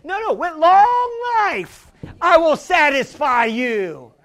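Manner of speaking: loud, slow, drawn-out pulpit declaration; emphatic proclamation. Real, not acted. Preacher declaring God's promise to the church, loud and slow proclamation. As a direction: stretch it out and proclaim it. Climactic scripture promise in sermon.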